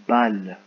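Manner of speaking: repeated loud and distinct; clear dictation. Shown as a demonstration. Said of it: "C'est parti".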